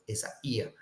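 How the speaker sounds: Isaiah softly as e-za-EE-a, four separate syllables; accent on the iota. Shown as a demonstration. Teacher repeating the correct accent for students, Isaiah as a soft, low e-za-EE-a. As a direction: stress the "ee" in Isaiah, keeping it apart from the a. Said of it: "The accent is on the yod".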